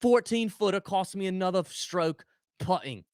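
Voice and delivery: put-on a light Aussie accent on a griping golfer; touch of an Australian accent complaining. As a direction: play a whining golfer in a mild Aussie accent. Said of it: "Now you sound a little Australian".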